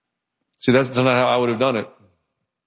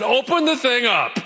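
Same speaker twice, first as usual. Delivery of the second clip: brisk command; 10 semitones higher than usual. Retold or imitated. Retold Jesus command.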